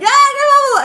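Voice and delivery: high, loud gleeful yell of kerfuffle; echoing a coworker's kerfuffle with a joyful high yell; excited shout. Retold or imitated. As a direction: shout it back with delight. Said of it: "and I was like".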